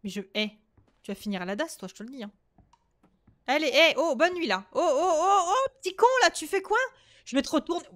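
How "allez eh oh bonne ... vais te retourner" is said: loud scolding. Real, not acted. Scolding the game child loudly.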